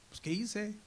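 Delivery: high, puzzled son asking what he did; innocent protest. Retold or imitated. Son to angry father, a high innocent what-did-I-do. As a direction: play the son who doesn't know his fault.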